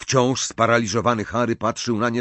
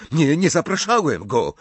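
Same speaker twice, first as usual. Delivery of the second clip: higher, louder breathless protest; panted denial; 7 semitones higher, 4 dB louder than usual. A narrator's reading. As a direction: gasp it out in panic. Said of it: "wydyszał Malfoy".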